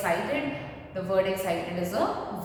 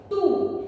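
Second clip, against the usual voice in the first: "too" said higher and louder; stressing too; 8 semitones higher, 5 dB louder than usual. Shown as a demonstration. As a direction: punch the word "too" out alone. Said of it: "T O O".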